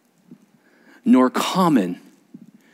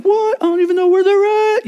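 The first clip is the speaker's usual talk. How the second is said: much higher, louder squeaky panic; panicked groom; 4 dB louder than usual. Retold or imitated. Groom caught without the rings, panicked. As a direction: play the clueless groom caught out. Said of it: "the groom's was like".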